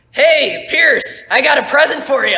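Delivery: high, loud captain's holler; calling out. Acted. Captain Joe calls to Pierce before a throw, loud holler. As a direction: holler it across the deck. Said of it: "and Joe goes".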